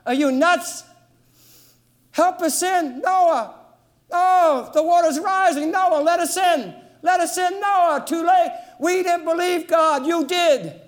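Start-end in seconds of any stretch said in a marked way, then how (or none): 2.1-10.8 desperate pleading shout. Acted. Drowning crowd begs Noah.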